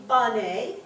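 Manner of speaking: "Bane" said slowly as BAH-nay; two drawn-out syllables in "Bane", BAH-nay; full AH vowel. Shown as a demonstration. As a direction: open the first vowel of "Bane" into a long AH, then NAY. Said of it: "if it was that".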